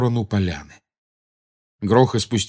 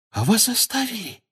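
astonished; 14 semitones higher, 3 dB quieter than usual. A narrator's reading. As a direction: ask in surprise, pitch soaring. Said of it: "поразилась Джинни". (поражённый вопрос высоким голосом)

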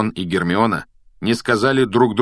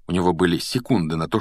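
usual speaking voice

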